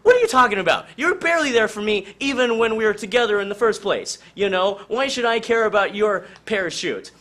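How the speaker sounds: wife yelling at her husband mid-fall, indignant; indignant shout. Acted. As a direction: throw the accusation right back at him. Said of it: "Yancey's like".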